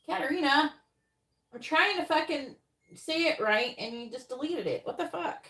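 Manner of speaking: high, loud exasperated yelling at her; blowing up over the deleted question; frustrated outburst. Real, not acted. Reader yelling at Katarina for deleting question, exasperated.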